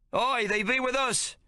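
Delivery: high, slow chorus of sailors agreeing; crew calling out. A narrator's reading. Crew to officer, high slow calling agreement. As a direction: sailors backing him up together. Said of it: "several call".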